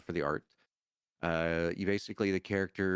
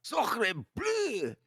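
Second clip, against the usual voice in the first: very high put-on cartoon voice; mock-shocked squeal; high cartoon exclamation. Mock French exclamation in character.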